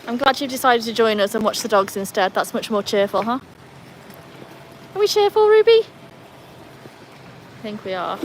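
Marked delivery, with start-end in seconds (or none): 4.9-5.8 loud, high pet-directed question; playful high-pitched call to a pet; excited dog talk. Real, not acted.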